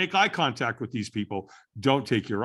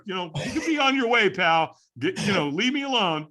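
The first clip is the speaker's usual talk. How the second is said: high-pitched, overdone "I'm good"; acted-out fob-off to send someone away; brushing off concern; 8 semitones higher, 4 dB louder than usual. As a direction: insist all is fine, pitched high. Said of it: "when we're not okay, what do we say".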